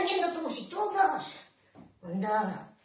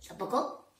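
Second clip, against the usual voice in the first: usual speaking voice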